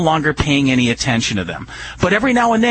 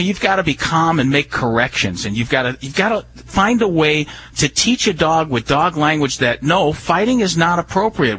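usual speaking voice

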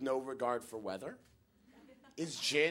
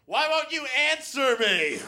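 high, loud exasperated shriek; screaming drunk girl; 12 semitones higher, 8 dB louder than usual. Retold or imitated. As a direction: shriek it in mock outrage. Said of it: "she starts screaming".

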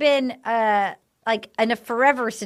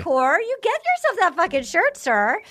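high, gushing urge to buy the shirt; excited gushing; 5 semitones higher than usual. Real, not acted. Host gushing at cohost Nick about the shiny shirt, high and excited. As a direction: squeal at him that he needs it.

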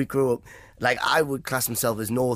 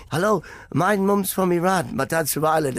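mock stage introduction; 6 semitones higher than usual. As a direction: lightly imitate a comic greeting the crowd. Acting out his stage opening.

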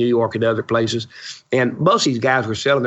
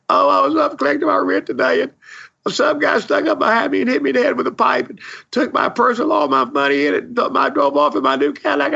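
put-on high, wailing old woman voice, much higher; imitating a crying old lady; 14 semitones higher than usual. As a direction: mimic her tearful panic after the robbery. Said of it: "she was crying".

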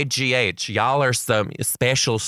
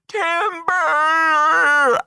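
high, drawn-out holler of "timber"; lumberjack call; 4 dB louder than usual. Acted. Kid warns the class of the falling kale, high drawn-out call. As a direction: imitate a kid bellowing like a lumberjack, long and high. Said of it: "cupped his hands to the rest of the class and went".

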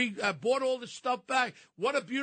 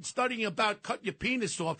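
normal talking voice

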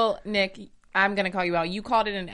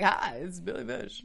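slow, put-on host greeting; Billy Bush; slower than usual. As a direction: lightly imitate a TV host. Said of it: "Billy Bush is really like".

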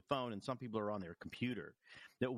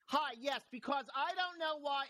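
shouting into phone; 13 semitones higher, slower than usual. Retold or imitated. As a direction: imitate someone yelling at a phone. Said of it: "they're just shouting into the phone".

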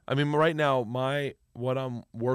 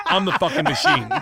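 laughing; 7 semitones higher, 9 dB louder than usual. Retold or imitated. Retelling daughter's line, laughing.

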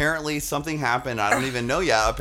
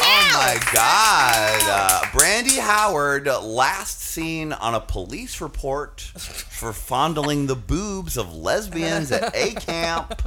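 slow dramatic gossip; much slower than usual. Acted.

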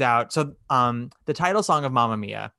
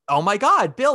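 startled gasp, pitched up and louder; suddenly recognizing an old flame; surprised; 7 semitones higher, 6 dB louder than usual. Retold or imitated. Donna spotting Bill, acting out her surprise. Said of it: "like, so surprised".

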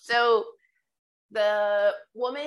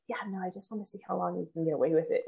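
boss, quick and hushed; 2 semitones lower, 8 dB quieter, much faster than usual. Retold or imitated. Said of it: "she's like".

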